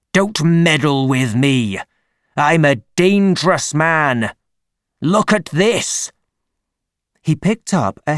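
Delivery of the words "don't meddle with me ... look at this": threatening big-man voice, rising in pitch; angry. A narrator's reading. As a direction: warn them off furiously. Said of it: "red-faced and angry".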